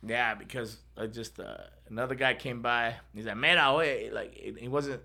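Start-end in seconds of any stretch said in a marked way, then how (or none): 3.4-4.1 put-on calling out "Mira, oye" in a South Florida Spanish accent, a bit louder. Passing stranger calls out to a stuck driver, South Florida Spanish accent. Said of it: "It was, like, South Florida Spanish".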